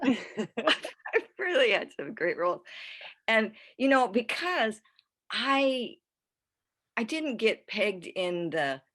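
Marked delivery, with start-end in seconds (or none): 0.0-2.6 laughing as it's said, higher and louder; real laughter running through slow words. Real, not acted. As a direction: say it through a big, delighted laugh. Laughs while repeating it.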